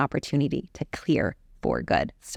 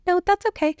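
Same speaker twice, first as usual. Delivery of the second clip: much higher, louder mock voice for his reply; imitating the therapist; 13 semitones higher, 4 dB louder than usual. Woman mimicking her therapist's brush-off in a high mock voice. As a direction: squeak out his breezy refusal. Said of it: "And he's like".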